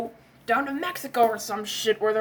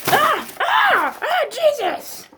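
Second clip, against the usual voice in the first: very high loud screams; panicked screams; 13 semitones higher, 5 dB louder, slower than usual. Acted. Men panicking at exploding fireworks, high loud screams.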